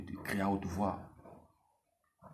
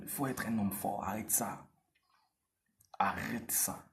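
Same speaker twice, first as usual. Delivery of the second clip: usual speaking voice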